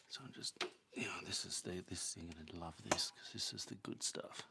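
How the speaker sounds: hushed conspiratorial whisper; whisper to the dog. Real, not acted. Owner whispering to the dog about a treat, hushed.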